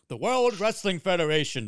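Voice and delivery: lightly voiced wrestling-show announcer; a measured, hinted announcer read; signature announcer voice. Co-host recalls nineties wrestling signature, light announcer voice. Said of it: "the signature was".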